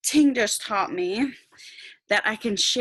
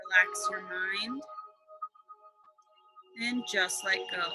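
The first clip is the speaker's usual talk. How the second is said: guided meditation; 8 semitones higher than usual. Acted.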